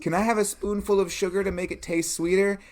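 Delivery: put-on high, fast child voice; pleading little kid. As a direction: ask quickly and high like a small child. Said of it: "And the kid's like".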